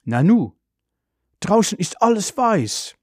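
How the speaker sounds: put-on high-pitched child's wonder; puzzled surprise. A narrator's reading. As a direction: wonder aloud at the unexpected sight. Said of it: "und wundert sich". (verwundert, mit hoher Stimme)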